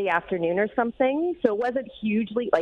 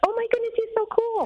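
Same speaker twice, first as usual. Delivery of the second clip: gushing daughters; 12 semitones higher than usual. Retold or imitated. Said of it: "they're like".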